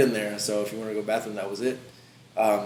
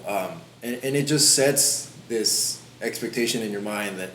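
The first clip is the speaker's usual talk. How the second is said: usual speaking voice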